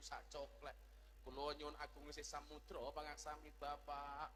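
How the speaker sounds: bow low and beg forgiveness; humble formal plea. Retold or imitated. Jogja wife's formal sungkeman. (memohon maaf dengan halus dan rendah)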